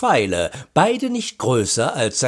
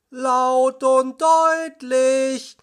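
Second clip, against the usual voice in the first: high, very drawn-out call; calling back; 11 semitones higher, much slower than usual. A narrator's reading. Justus confirming he hears Peter, drawn-out calling. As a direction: stretch each word out across the distance.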